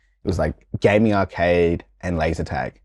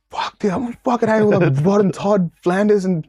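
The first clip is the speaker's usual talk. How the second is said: high, mock-outraged teen rant; angry teen; 10 semitones higher than usual. Boy fuming on the camp bus, in a high mock-outraged rant. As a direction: rant like a furious twelve-year-old. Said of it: "I'm so angry".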